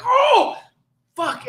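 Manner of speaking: sudden high shocked oh, then a curse. Retold or imitated. Cop finding the headless man, shocked curse. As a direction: react to a headless body. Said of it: "I turn around, I'm like".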